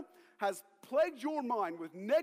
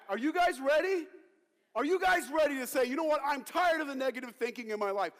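loud, high rallying call; fired-up preaching; 6 semitones higher, 6 dB louder than usual. Real, not acted. Preacher rallying congregation against being boxed in, fired-up. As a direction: rouse the crowd with forceful preaching.

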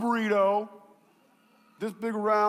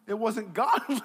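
normal talking voice

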